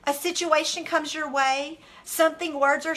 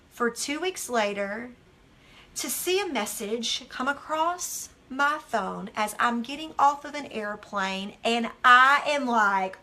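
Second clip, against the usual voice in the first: ordinary talk, nothing marked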